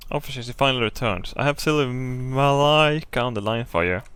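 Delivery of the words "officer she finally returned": normal talking voice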